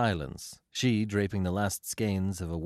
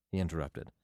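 plain reading voice

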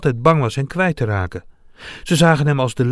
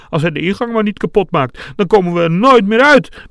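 high, frightened voice getting louder; trembling fear; 7 semitones higher, 6 dB louder than usual. A narrator's reading. Loppie trapped by the dragon, trembling with fear. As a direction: quaver with rising panic. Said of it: "bibberde Loppie".

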